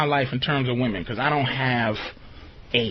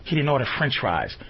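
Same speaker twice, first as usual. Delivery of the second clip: imitating girlfriend; 6 semitones lower, 4 dB quieter than usual. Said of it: "opens her mouth to go".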